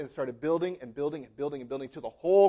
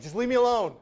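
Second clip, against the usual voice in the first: higher, mildly exasperated outburst; mock exasperation; 5 semitones higher, 4 dB louder than usual. Someone pressed in by a crowd, mock exasperation. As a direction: lightly act out being fed up with crowding.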